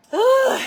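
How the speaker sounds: loud high ugh; exhausted groan. Retold or imitated. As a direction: groan like you're spent. Exhausted after each run.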